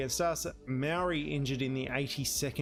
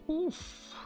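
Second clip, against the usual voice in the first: pained oof. Real, not acted. Wincing at the result.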